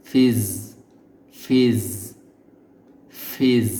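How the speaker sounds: ফিজ said with a buzzing z, tongue tip forward; buzzing z demo. Shown as a demonstration. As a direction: repeat ফিজ buzzing the final ঝ. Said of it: "জিহ্বার আগা এখানে চলে যাবে". (গুঞ্জন দিয়ে ফিজ বলা)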